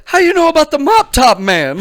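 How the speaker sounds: mock disbelief at the nickname; incredulous guest. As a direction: demand to know how he found out. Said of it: "And you're like".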